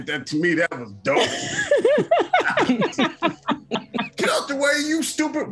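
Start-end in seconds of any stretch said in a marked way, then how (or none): 1.3-4.1 squealing high-pitched laugh; delighted high cackle. Real, not acted. Laughing at shooting scene. 4.2-5.5 yelled high, laughing through it; mock shout at someone in the way. Quoting movie scene, laughing.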